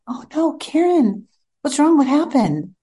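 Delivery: high, worried rush of questions; husband startled to find his wife crying, high and alarmed. Retold or imitated. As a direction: sound like he's just found his wife crying. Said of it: "And he says".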